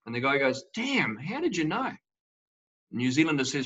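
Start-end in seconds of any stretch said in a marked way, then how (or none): 0.8-1.9 high, swooping surprised reaction; mock astonishment. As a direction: play the caught-out joke character, pitch jumping up. Joke character caught out, astonished.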